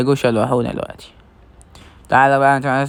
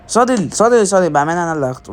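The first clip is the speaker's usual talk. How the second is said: much higher, fast and loud; snatch it before anyone else, eager; excited grab; 4 semitones higher, 4 dB louder than usual. Real, not acted. Gamer claiming the saddle from friends, excited.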